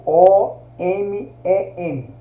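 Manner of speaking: O M E M spelled letter by letter, slowly; slow letter-by-letter spelling for the class. Shown as a demonstration. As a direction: spell out O M E M one letter at a time. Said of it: "Eu não escrevo".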